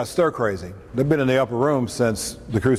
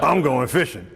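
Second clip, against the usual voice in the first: put-on Southern accent; 3 semitones higher than usual. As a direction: announce it in a mild Southern US accent. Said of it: "hear him in a southern accent".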